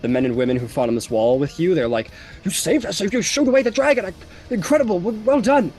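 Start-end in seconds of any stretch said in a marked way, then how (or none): 2.4-5.7 high, loud, gushing congratulations; excited praise. Acted. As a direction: heap praise on the hero who saved you. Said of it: "like, praise and, like, patting on the back".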